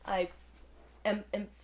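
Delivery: usual speaking voice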